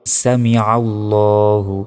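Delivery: "সামিআল্লাহু" drawn out, long rounded "ল্লা"; correct recitation. Shown as a demonstration. Teacher showing words for rising from ruku, slow recitation. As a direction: slowly stretch "সামিআল্লাহু". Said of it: "ওঠার সময় কি বলতে হয়?".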